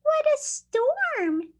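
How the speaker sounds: high little bird voice; twittering wren. Acted. Teacher voicing the wren for the class, high twittering voice. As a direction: chirp it up high like a tiny wren. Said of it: "twitters wren".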